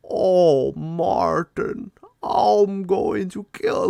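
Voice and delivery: imitating a stupid deep demon voice, slowed and drawn out; mock the movie's bad villain voice, dragging the words; hammed-up cheesy demon growl; dumb demon voice. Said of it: "stupid fucking deep, dumb voice".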